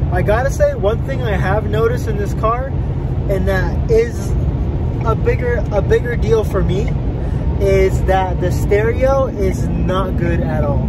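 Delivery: ordinary talk, nothing marked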